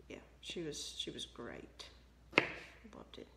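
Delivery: low, soft, underplayed praise; quiet praise that comes out sounding sarcastic; unintentionally sarcastic. Real, not acted. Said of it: "That sounded sarcastic when I said it like that".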